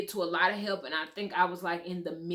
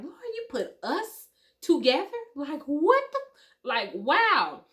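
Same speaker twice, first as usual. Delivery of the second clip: amazed disbelief; 9 semitones higher, 6 dB louder than usual. Retold or imitated. Amazed quote to God.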